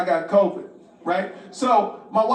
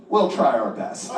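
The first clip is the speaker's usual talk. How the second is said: grave doctor; 9 semitones lower than usual. Retold or imitated. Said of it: "looks me straight in the eye and goes".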